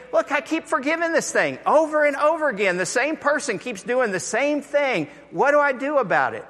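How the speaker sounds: high, fast exasperated Peter; Peter's plea. Retold or imitated. Peter complaining to Jesus, high and hurried. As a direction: plead it fast and high, fed up. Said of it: "And so Peter's saying".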